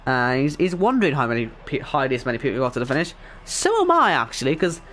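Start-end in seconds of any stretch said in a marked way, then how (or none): 3.4-4.1 jumps way up, louder, in disbelief; a high, loud so am I; incredulous. Real, not acted. Commentator baffled so many finished, high disbelieving yelp.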